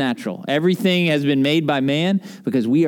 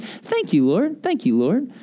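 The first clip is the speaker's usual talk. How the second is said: imitating old lady; 5 semitones higher, slower than usual. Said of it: "she would go".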